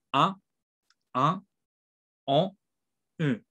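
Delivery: "A", "A", "O", "U" nasalized as in French; nasal vowel repeat drill; French nasal vowels again. Shown as a demonstration. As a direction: say each French nasal slowly, through the nose. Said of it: "Entonces, otra vez".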